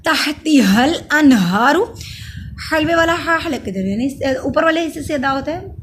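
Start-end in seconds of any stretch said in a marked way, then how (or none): 0.1-1.9 slow, loud tajweed recitation of तहती हल अनहारू; izhar recitation. Shown as a demonstration. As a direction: clear unhummed n in अनहारू, long aa. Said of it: "अन पे इजहार होगा".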